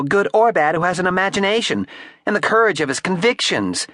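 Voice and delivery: put-on high-pitched haughty girl; defiant flapper. A narrator's reading. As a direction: defy him with scorn.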